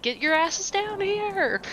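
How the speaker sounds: high, stretched-out shout without real volume; mock shout. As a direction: call up to the others, high and drawn out. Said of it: "like, shout up to us".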